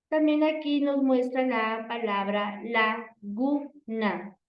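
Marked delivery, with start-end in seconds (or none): 2.6-4.4 "laguna" in three separate beats, la, gu, na; split into syllables. Shown as a demonstration. Teacher showing three voice beats in "laguna", syllables separated. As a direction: pause after each syllable of "laguna". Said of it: "los tengo que pronunciar y escuchar".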